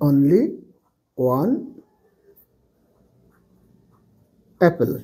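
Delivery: he has only one apple, word by word, slowly; slow dictation. Shown as a demonstration. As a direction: spell it out for the class one word at a time. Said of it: "তখন Apple হবে". (ধীরে ধীরে শব্দ ধরে বলা)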